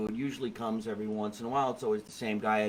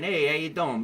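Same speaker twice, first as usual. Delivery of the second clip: New York greeting; 6 semitones higher, 6 dB louder than usual. Retold or imitated. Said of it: "makes me a little bit lower".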